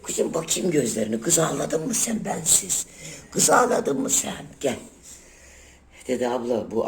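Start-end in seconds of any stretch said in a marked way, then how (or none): 0.0-4.8 climbing higher and higher, cooing. Real, not acted. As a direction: fuss over the pet, voice soaring. Baby talk to her pet. (giderek yükselen bebeksi ses)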